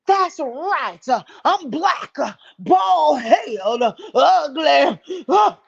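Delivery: loud, high hammed-up outrage; mock angry woman. Host mimicking the rival admitting it, mock angry. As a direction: stretch each word in a mock furious shout.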